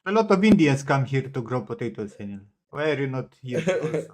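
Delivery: playful Indian uncle tone, teasing; Indian accent. Retold or imitated. Teasing his friend to come grow potatoes, light mock Indian accent. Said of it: "Indian accent".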